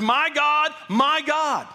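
high, loud cry to God; agonized scream. Acted. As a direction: scream it out in acted agony. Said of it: "he's screaming the words".

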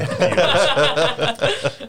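slow words broken by laughter; laughing. Real, not acted. Laughing at a funny image.

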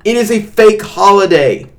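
high, loud, emphatic dismissal; heated rant. Real, not acted. Co-host dismissing a fake holiday, heated and emphatic. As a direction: hammer it home, worked up and loud. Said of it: "you getting heated".

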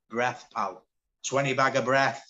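loud, mock street-deal brag; jokey boast. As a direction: land it loud like a dealer's patter. Acted banter about being high.

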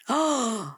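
sharp high gasp of mock shock; playful shocked inhale. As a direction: gasp as if scandalized. Mock shock at family reaction.

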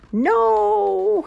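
loud, high, drawn-out no; emphatic no. A narrator's reading. Narrator answering whether Groundhog learned, emphatic drawn-out no.

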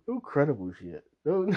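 ordinary talk

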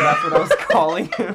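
laughing through the words; can't stop laughing. Real, not acted. Laughing at own mistake.

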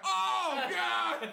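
high, loud groan of disgust; exasperated shout. Retold or imitated. Family members yelling in disgust at the kid. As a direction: throw up your hands and shout it. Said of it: "They're like".

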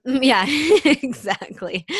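laughing through the words. Real, not acted. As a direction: laugh as you agree. Laughing while agreeing.

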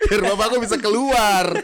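can't get the words out for laughing; the teller delivers the punchline to his co-host, laughing; laughing through the punchline. Real, not acted.

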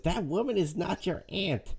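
a hint of a cold, disapproving grandmother; imitating Livia. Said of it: "and Livia says".

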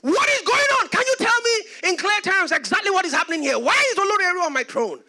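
high, shouted interrogation; upset father. Retold or imitated. Father demanding answers from his son, shouting in outrage. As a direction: hammer out angry questions, acting the father. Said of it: "he was so upset".

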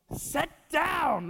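high-pitched mock yell at a child. Preacher acting out snapping at a restless child, mock yell. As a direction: yell it high without full volume. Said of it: "you just wanna yell".

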